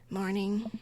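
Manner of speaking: whispered, sullen morning. Acted. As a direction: mumble it, sulking. Sullen teen reply.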